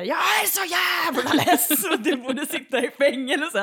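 high, loud, drawn-out mock rant; hammed-up yelled complaint; sour old man shouting. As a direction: bellow it like a grumpy balcony neighbour. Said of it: "sur jävla gubbe på en balkong som skriker".

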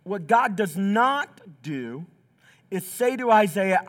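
normal talking voice

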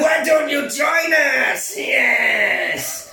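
high, shouted mock-Mexican accent, drawn out; loud, slow, bad Cheech Marin impression. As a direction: stretch it into a big hammed-up Mexican-villain invitation. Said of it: "All of your accents sound like Cheech Marin".

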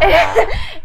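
real high tearful laugh. Real, not acted. Laugh after name called.

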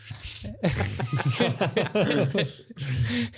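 high, drawn-out giggling fit; long laugh. Real, not acted. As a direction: crack up and keep laughing. Laughs at own remark.

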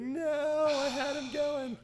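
high, loud cry of dismay; dad groaning that his work was undone. Retold or imitated. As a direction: wail it like a let-down father. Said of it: "And he's like".

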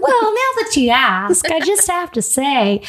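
high, hammed-up mock-interviewee voice; put-on character voice. Host joins the mock interview about dying, high and hammy. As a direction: play it up high, relishing the answer. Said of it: "me and Emily do a lot of voices".

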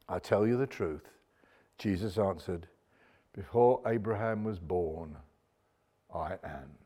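hushed, low and weighty; solemn declaration. Acted. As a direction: drop right down for a grave, measured revelation. Voicing Jesus's solemn answer.

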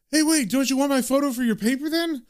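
high-pitched, eager cop voice; eager cop calling out. A narrator's reading. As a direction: call after someone walking off, pitched well up. Cop calls after departing John.

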